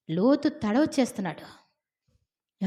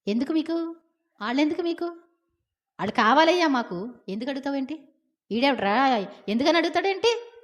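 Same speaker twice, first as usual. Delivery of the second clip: mock angry mob; 5 semitones higher than usual. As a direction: bark at Lot like an angry crowd. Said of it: "ప్రతి ఒక్కళ్ళు చాలా సీరియస్గా".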